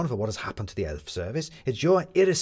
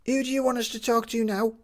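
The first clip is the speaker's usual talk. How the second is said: put-on high young voice, clearly raised; the captain; 12 semitones higher than usual. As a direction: voice the captain as a high-pitched kid. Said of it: "said the captain".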